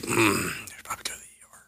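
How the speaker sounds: fast, whispered brush-off; quick hushed dismissal; dismissive whisper. Retold or imitated. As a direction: murmur it like a bored nurse. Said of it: "they're like".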